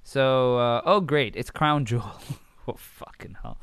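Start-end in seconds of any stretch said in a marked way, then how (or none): 2.7-3.6 under-breath curse. Real, not acted. Reacting to next pay-per-view.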